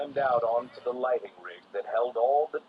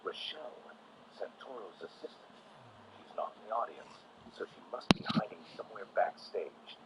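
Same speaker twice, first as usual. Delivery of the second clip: hushed; 2 semitones lower, 10 dB quieter than usual. A narrator's reading. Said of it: "Batman continued".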